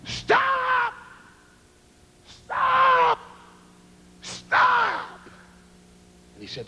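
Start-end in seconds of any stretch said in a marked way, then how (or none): 0.0-5.2 high, drawn-out warning shouts; retold scream. Man warning drivers the bridge is out, screaming. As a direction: yell each stop to stop the cars. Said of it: "jumping and screaming".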